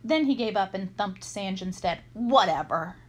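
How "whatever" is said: loud, dismissive grunt. A narrator's reading. Boy giving up on arguing with his accomplice, grunted. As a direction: toss it off curtly. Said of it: "he grunted".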